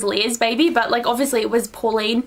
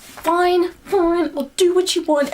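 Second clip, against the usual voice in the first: high-pitched mock surrender; hammed-up exasperated "fine"; reluctant giving in; 6 semitones higher, slower than usual. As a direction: give in with a high, put-on voice. Acting out a character giving in.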